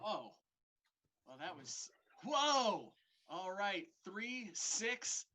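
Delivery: ordinary talk, nothing marked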